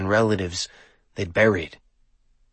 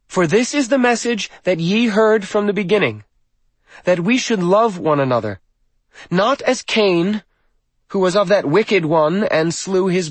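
put-on high, loud declaiming young woman; scripture read aloud; 10 semitones higher, 5 dB louder than usual. A narrator's reading. Girl reads Bible verses to congregation, high loud declaiming.

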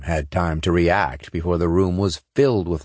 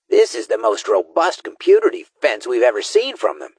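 raised-pitch tech specialist voice; higher character voice; 6 semitones higher than usual. A narrator's reading. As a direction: speak as the squad's tech expert. Gee Whiz character voice.

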